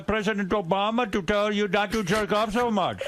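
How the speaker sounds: mock Indian accent. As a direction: scold him in a fake Indian accent. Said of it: "He does not speak like that".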